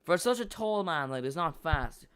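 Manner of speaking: usual speaking voice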